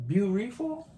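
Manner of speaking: "Beautiful?" with an R, "beau-ri-ful", rising; mock mispronunciation. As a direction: tease him with his "Beautiful?". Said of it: "any R's in beautiful".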